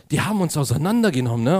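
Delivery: ordinary delivery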